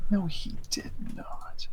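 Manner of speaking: breathy whisper of denial; whispered disbelief. Real, not acted.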